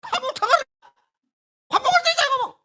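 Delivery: high, loud shouting at the spouse; quarrel shout. Retold or imitated. Spouses quarrelling, one yells in a high loud shout. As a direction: yell it like a fighting husband. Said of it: "Pasti kan keras".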